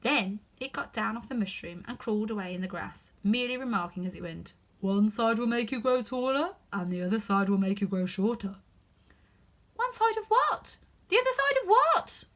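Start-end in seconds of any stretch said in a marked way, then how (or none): 4.8-8.6 a drowsy creature giving advice; caterpillar voice. Acted. Caterpillar advising Alice, lightly voiced. 9.8-12.2 put-on much higher, louder girl's voice, puzzled; asking herself, baffled and curious; puzzled Alice. Alice puzzling over the caterpillar's words, high and bewildered. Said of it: "thought Alice to herself".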